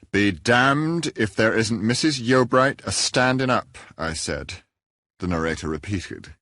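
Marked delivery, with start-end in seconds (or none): 0.1-3.8 slow, grave and louder, no relish in the curse; a severe, unsmiling repeat of the curse; passionless severity. A narrator's reading. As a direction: deliver the oath with stern, joyless gravity. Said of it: "with the same passionless severity".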